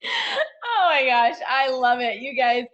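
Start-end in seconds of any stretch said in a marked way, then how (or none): 0.0-1.4 high, loud and drawn-out oh my gosh; delighted gasp. Real, not acted. Cohost gushing over the team story, loud and high.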